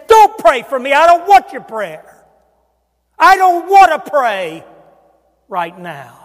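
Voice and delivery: loud, high, forceful push-back; mock-angry outburst, pushing the prayer away; retold angry refusal.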